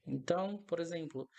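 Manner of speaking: ordinary delivery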